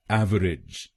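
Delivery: एवरेज said as ev-er-ij, three syllables; teacher models एवरेज as ev-er-ij; three-syllable pronunciation. Shown as a demonstration. Teacher shows students the first way, three-syllable ev-er-ij. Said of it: "पहला है".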